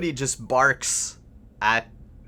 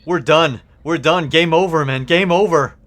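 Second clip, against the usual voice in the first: louder, panicked cry of doom; panicked shout; 4 semitones higher, 10 dB louder than usual. Acted. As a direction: yell it like all is lost. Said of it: "and says".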